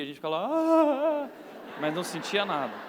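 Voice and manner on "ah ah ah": high, hammed-up fake yelps; faked cries of pain. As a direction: overact the pain a kid fakes. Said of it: "e a gente fingia". (gemidos de dor fingidos, agudos e exagerados)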